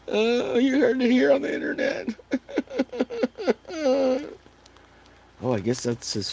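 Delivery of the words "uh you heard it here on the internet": squeaky giggling; giggling falsetto aside; laughing high. Real, not acted.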